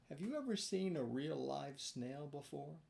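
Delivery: plain reading voice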